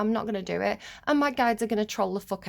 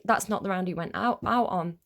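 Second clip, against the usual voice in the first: normal talking voice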